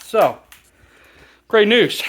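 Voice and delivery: great news called out high and loud; bright triumphant announcement; excited. Real, not acted. Announcing the first part removed.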